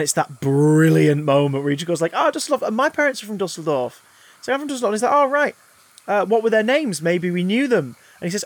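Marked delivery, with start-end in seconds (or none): 2.1-4.9 much higher, mock-cheery Magneto; sly sweet bait to the Nazis; feigned delight. Magneto feigning delight, high pitch. 5.1-8.0 eager reply. Retold or imitated.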